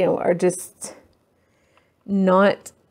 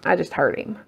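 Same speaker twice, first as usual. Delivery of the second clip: ordinary talk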